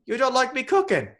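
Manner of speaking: high, loud wounded old-seadog impression; hammed-up offended cook; Willem Dafoe. Old keeper hurt about his cooking, mock-wounded cry. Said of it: "Willem Dafoe goes".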